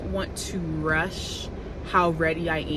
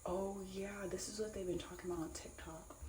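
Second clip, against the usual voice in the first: whisper; 16 dB quieter than usual. Real, not acted.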